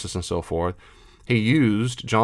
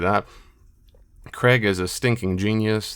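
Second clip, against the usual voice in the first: normal talking voice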